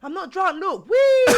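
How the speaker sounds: loud, high hammed-up drunk cheer; giggly drunk. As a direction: play a drunk showing off to police. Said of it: "retold drunk cartwheels".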